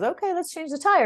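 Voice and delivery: a hint of an easygoing mechanic saying yes; lightly voiced mechanic. Mechanic cheerfully agreeing with the frustrated driver, lightly voiced. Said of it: "And the guy goes".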